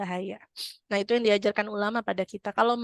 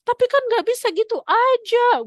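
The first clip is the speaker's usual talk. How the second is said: high, loud, indignant objection; protesting woman; 13 semitones higher, 8 dB louder than usual. Retold or imitated.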